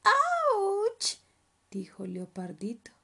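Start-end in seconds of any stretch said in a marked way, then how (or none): 0.0-1.2 high, loud, drawn-out yelp; long squealed ouch; screamed ouch. Acted. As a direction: cub crying out in pain. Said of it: "dando gritos".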